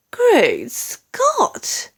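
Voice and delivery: a high startled exclamation; startled cry. A narrator's reading. Queen seeing the headline, startled cry. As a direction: cry out in shock. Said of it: "cried the famous voice".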